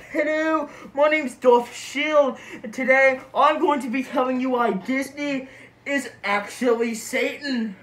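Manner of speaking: muffled behind hard mask; speaking into covering mask; through a face mask. Real, not acted. Seen 0: Hard mask covers whole face and mouth throughout the words.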